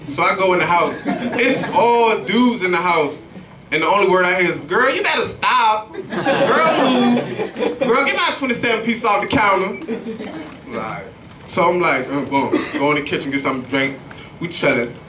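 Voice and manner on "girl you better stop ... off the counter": mock sassy voice. Mocking men at house.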